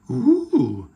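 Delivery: loud, airy "ooh" of wonder; breathy awed gasp; excited gasp. A narrator's reading. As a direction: gasp in amazement like thrilled children. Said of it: "gasped Peppa and George".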